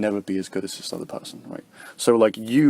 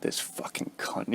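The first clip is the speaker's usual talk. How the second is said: whispered contempt; 11 dB quieter than usual. Retold or imitated. Aggressive person's resentful thought.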